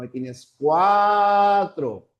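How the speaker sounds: cuatro dicho despacio, acento fuerte en CUA de cuatro; palabra de ejemplo marcada. Shown as a demonstration. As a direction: marca la sílaba CUA de cuatro, lento y claro. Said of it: "tienes la palabra". (pronuncia cuatro despacio, golpeando CUA)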